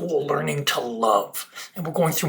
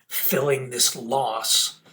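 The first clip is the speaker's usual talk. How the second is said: ordinary delivery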